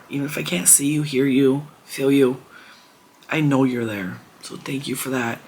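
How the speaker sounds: ordinary talk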